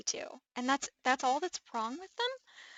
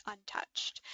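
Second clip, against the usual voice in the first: whispered; 4 semitones lower, 6 dB quieter than usual. Real, not acted. Admiring a perfect doll dress.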